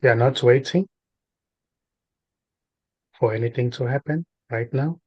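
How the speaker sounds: spaced-out phrases with long silences between; guided meditation. Real, not acted. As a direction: guide a listener gently into stillness, unhurried.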